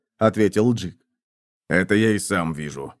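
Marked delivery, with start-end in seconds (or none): 1.7-2.9 low, gruff mutter; grumbling. A narrator's reading. Centurion answers the lookout's obvious report, low grumble. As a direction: grumble it under your breath. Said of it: "буркнул стоявший рядом сотник".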